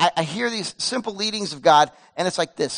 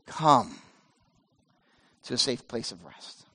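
low, much softer invitation; gentle God; 9 semitones lower, 8 dB quieter, slower than usual. Acted. God softly calling worn-out Elijah to rest, low and gentle. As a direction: ease him in like a tender father.

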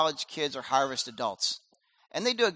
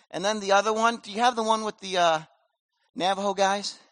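ordinary delivery

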